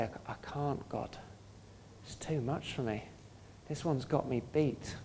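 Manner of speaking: soft, broken confession to God; hushed prayer of giving up; humble quiet surrender. Acted. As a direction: let go and admit it quietly. Humble prayer admitting defeat to God.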